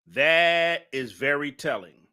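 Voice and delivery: pronouncing judgment; slow emphatic verdict. Real, not acted. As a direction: land "That is very telling" heavily.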